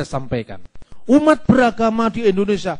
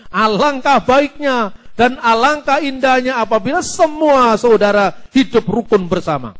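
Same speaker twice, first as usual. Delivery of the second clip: raised, louder sermon delivery; preaching demonstration; 4 semitones higher, 4 dB louder than usual. Shown as a demonstration. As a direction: declaim it to a congregation. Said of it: "nah, mulai saya bikin gitu".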